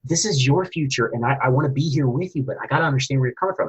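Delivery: high, pushing, frustrated dad voice; losing temper. Retold or imitated. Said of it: "I actually lost my temper".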